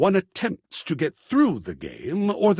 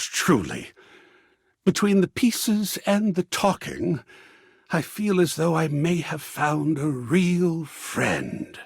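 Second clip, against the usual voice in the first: ordinary talk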